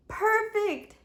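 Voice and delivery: high, bright pleased perfect. Acted. Jimmy finishing the repair on Dewey, high pleased perfect. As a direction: sound satisfied with the fix. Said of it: "Jimmy said".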